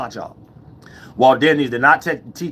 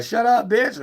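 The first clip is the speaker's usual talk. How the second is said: much higher, lighter take; playful, not negative; 11 semitones higher, slower than usual. Shown as a demonstration. Showing how to hush her, high and playful. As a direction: lift it high so it isn't an insult. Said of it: "You saying".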